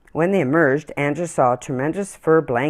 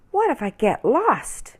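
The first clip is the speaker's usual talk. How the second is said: put-on higher, small worried child voice; worried little girl; 9 semitones higher than usual. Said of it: "she wondered aloud".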